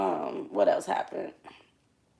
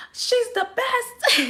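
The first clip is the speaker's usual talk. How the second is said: much higher and slow, mocking the friend; mock friend's voice; much slower than usual. Friend hyping her up in a high, slow mock voice. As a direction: stretch it out high like a hyped-up buddy. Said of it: "He was like".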